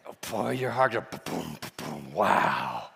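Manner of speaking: "boom, boom" dropped low like a pounding heart; heartbeat boom. Retold or imitated. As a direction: thump out the heartbeat deep.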